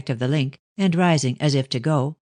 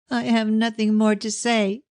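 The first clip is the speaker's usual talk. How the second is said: higher, a mild burst of tears; tearful cry; 7 semitones higher than usual. A narrator's reading. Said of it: "she cried with a fresh burst of tears".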